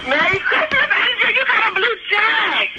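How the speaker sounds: high-pitched, laughing cheer; excited shout. Real, not acted. Relative breaking the blue check news to Angel, excited shout. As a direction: shout the good news in delight.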